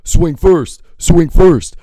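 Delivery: high, loud mocking yell of a street guy; taunting yell. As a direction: goad him, shouting. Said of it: "he's like".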